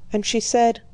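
ordinary talk

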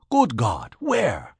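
startled outburst. A narrator's reading. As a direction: jump as if caught off guard.

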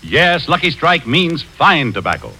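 ordinary talk

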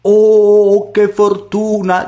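high, long drawn-out "Oh"; delighted exclamation. A narrator's reading. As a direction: gloat over sudden luck, slow and high. Mother delighted at the riches.

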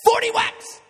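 high, loud mock-angry dad; dad's angry threat. Child retelling his dad shouting a threat. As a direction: bark it like a furious father. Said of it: "my dad would go".

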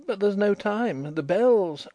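put-on high-pitched timid girl's protest; timid, childish. A narrator's reading. Nellie objecting to leaving the ship, timid childish voice. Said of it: "extinguishing her timid, childish voice".

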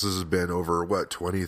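ordinary delivery

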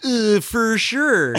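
slow, high folksy Southern "for sure"; a hammed-up Southern drawl to blend in at the bar; folksy Southern put-on. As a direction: stretch it out like a good old boy. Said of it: "I just do, like, a folksy thing".

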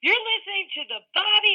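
high, loud show-intro announcement; radio bumper voice. Acted. Guest mock-recording the host's show bumper, announcer style. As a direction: announce the show like a jingle read. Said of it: "Can I use that as my bumper?".

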